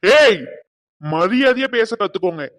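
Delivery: loud sharp yell to cut her off; angry shout. Acted. Groom silencing the rude aunt with a loud shout.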